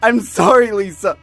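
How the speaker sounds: high, wailing apology swelling to shouts; desperate, wailed pleading; anguished begging. Acted. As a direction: plead over and over as if losing her, high and breaking out loud. Begging her not to die.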